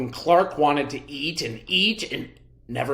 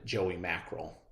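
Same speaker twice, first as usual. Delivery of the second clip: ordinary delivery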